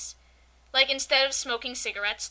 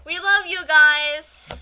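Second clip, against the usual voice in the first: loud, drawn-out affection; gushing shout-out; 3 semitones higher, 10 dB louder, much slower than usual. Pretend. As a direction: call it out slowly to the fans. Calls out to podcast friends.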